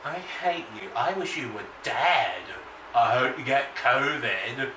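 ordinary delivery

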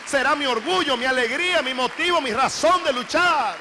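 rising, loud, overflowing joy; joyful. Retold or imitated. Young mother telling preacher her new joy, jubilant. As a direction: let the joy build and swell.

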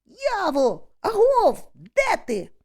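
high, loud searching calls; hallooing for a lost friend; full-voiced shout. A narrator's reading. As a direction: holler across a field for someone. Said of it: "кричу я на повну губу".

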